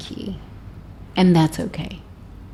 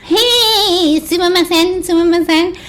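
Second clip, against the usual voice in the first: apologetic; 8 semitones higher than usual. Retold or imitated. Laughing in Japan, apologizing.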